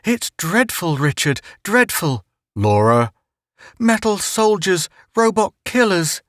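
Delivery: mildly frightened high mother voice; a touch of alarm in her voice; mother fearing a robot army. Acted. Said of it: "My mother looked frightened".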